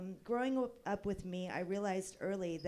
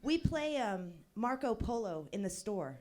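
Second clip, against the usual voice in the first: usual speaking voice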